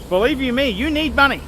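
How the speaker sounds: usual speaking voice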